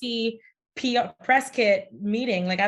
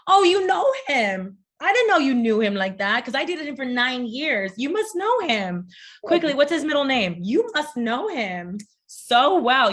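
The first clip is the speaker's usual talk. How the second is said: sarcastic mock; 3 semitones higher than usual. Sarcastic reply to doubting women.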